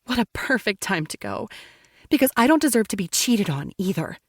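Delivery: plain reading voice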